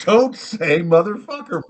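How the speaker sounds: slow, loud, high-pitched reprimand; scolding owner. Acted. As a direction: lay down the law as a fed-up pet owner. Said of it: "He's like".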